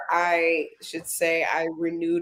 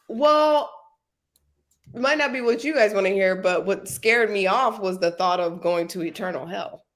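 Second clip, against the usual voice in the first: usual speaking voice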